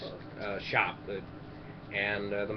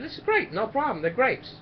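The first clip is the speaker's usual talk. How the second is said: cocky; 8 semitones higher than usual. Acted. Joke character boasting confidently.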